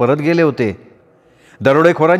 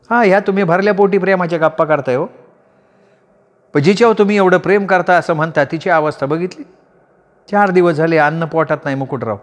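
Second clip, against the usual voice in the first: put-on higher-pitched voice for the young woman; the young woman calmly admonishing Mukutrao, higher voice; young woman's voice; 6 semitones higher than usual. A narrator's reading. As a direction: lift the voice into the woman, firm and steady.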